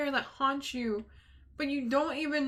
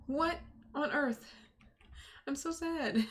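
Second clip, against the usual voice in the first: ordinary talk, nothing marked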